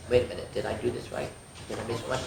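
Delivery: plain reading voice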